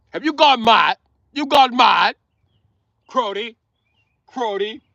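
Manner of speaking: high-pitched mock British disbelief; British accent. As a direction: play an incredulous Brit with a put-on accent. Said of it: "The British made up that word".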